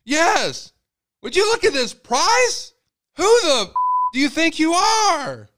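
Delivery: very high, loud, slow indignant yelling; angry dad confrontation. Retold or imitated. Father yelling at the butcher about the price, very high and loud. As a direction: demand an answer from the butcher.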